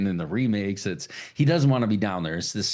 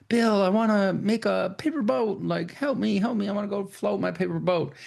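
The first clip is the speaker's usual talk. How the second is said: put-on much higher little-kid voice, pleading; little Georgie begging; 11 semitones higher than usual. Georgie begs his big brother Bill for help, high child voice. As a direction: play the small brother pestering Bill to help.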